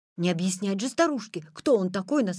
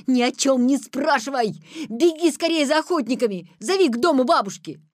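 loud, raised, urgent orders; full-force shouting; 4 semitones higher, 6 dB louder than usual. A narrator's reading. Petya sending the girl for hunters, urgent shouting. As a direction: shout commands with all your might. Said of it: "закричал изо всех сил".

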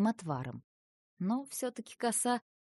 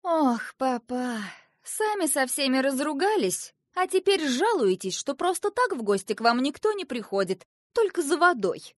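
higher, louder chiding daughter; reproachful princess; 6 semitones higher, 6 dB louder than usual. A narrator's reading. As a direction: scold your father gently.

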